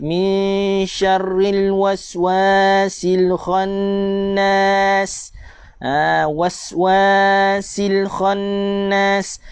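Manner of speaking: very slow chanted recitation with long vowels; correct slow recitation. Shown as a demonstration. Teacher showing the right length of the vowels, slow and chanted. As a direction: stretch "waswasil khannas" with proper long and short vowels. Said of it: "jaga panjang pendek".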